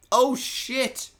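high, loud oh shit; suddenly remembering; a real start of surprise; sudden realisation. Real, not acted.

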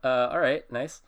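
high, loud startled "oh, okay"; startled and awkward. Retold or imitated. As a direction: fake polite surprise, caught off guard. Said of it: "And I was like".

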